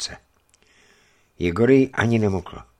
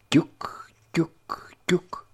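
whispered knock; 10 semitones higher, much slower than usual. Acted. Imitating knocking sounds.

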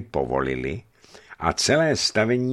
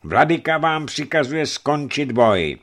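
forceful address; 5 semitones higher, 6 dB louder than usual. A narrator's reading. Archimandrite commands the fighting crowd to stop, forceful address. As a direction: thunder the bishop's order to the crowd. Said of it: "důrazně oslovil zástup".

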